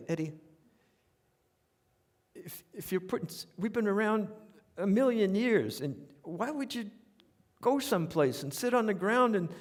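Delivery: the know-it-all New York father scolding Eddie about camping; New York smartass dad. Retold or imitated. Father telling his son camping is beneath them, New York smartass. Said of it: "he was a New York, um, smartass".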